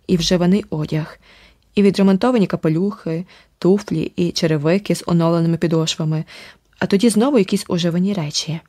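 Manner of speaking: ordinary delivery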